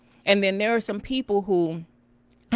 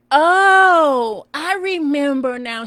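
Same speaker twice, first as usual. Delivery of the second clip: loud high oh, then slower; a loud, high oh of remembering; sudden realization; 8 semitones higher, 8 dB louder, much slower than usual. Retold or imitated. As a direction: it all comes back at once. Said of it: "And I was like".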